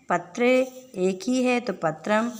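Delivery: ordinary delivery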